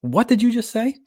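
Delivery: high-pitched, incredulous question; mock bafflement. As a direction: imitate a baffled listener, voice jumping up. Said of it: "they look at me like".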